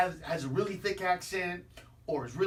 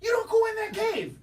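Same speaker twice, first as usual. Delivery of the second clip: emphatic shout; 12 semitones higher, 5 dB louder than usual. Acted. Warning about entering the cave.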